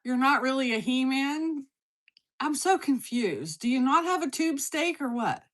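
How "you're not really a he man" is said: ordinary talk, nothing marked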